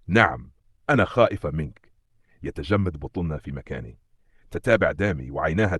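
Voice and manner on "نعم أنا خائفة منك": ordinary delivery, nothing marked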